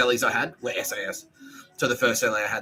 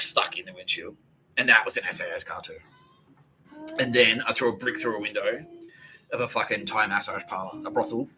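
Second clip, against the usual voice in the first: usual speaking voice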